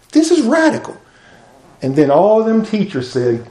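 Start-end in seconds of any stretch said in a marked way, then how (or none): 0.1-1.0 excited exclaim. Real, not acted. Exclaiming, very high pitch.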